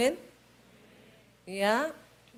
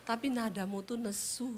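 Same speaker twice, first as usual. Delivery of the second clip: ordinary delivery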